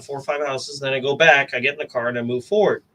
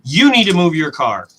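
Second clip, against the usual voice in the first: louder, curt mock of a rude neighbor; snarky demand; 3 semitones higher, 5 dB louder than usual. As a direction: bark it like an annoyed stranger. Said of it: "so snarky at me, like, so mean".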